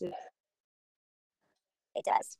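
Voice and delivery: breathy whisper, no voice. Shown as a demonstration. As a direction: whisper it to the child.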